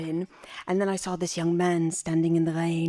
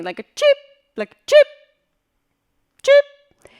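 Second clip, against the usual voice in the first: a shrill, loud detector cheep, much higher; high cheep imitation; 12 dB louder than usual. Shown as a demonstration. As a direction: mimic the beeping alarm. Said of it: "more like a cheeping".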